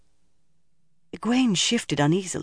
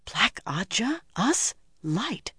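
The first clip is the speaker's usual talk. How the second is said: high, whispered disbelief; shocked; 6 semitones higher, 7 dB quieter, slower than usual. A narrator's reading. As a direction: gasp it softly in shock. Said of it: "Egwene gaped in shock".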